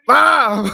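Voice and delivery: loud high startled scream then laugh; scared shriek into laughter. Real, not acted. Gamer hit by a jump scare, loud shriek then laughter.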